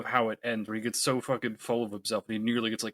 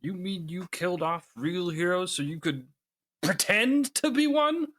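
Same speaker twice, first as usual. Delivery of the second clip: indignant hero; 7 semitones higher than usual. Acted. Mr. Incredible reply.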